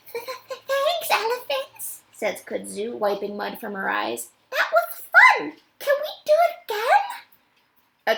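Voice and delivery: put-on high, delighted little-kid voice; a tiny ape giggling after a thrill; little gorilla child. Rescued baby gorilla thanks the elephants, delighted.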